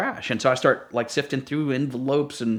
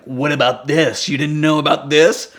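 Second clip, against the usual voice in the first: pointed question; 3 semitones higher, 6 dB louder than usual. Retold or imitated. Confronts driver again.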